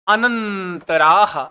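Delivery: अनंतराह very slowly, syllables stretched; drawn-out term. Shown as a demonstration. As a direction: hold the nasal and long vowel in अनंतराह. Teacher stretches the new term. (अनंतराह को खूब खींचकर, धीरे)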